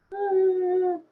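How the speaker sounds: very high, thin "Ah" like a distant woman; high voice imitation. Streamer imitates the woman he hears. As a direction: mimic her voice as a high squeak. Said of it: "her voice is like".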